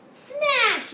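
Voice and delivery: high, loud gleeful yell; baby sister copying the word, high and loud; toddler yell. A narrator's reading. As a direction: shout it like a delighted toddler. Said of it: "yelled Honey".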